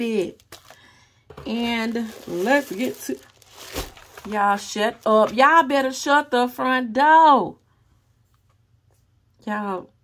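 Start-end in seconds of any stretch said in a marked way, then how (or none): 4.3-7.6 loud, slow, thrilled shout of disbelief; excited yelling. Real, not acted. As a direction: shout it out in delighted shock. Excited unboxing exclamation.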